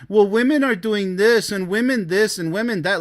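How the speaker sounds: sing-song parody of a whining guy; mock whiny complaint. Mocking a man who blames women, whiny parody. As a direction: whine it like a sulky man.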